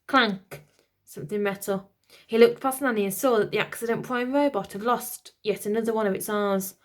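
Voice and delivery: ordinary delivery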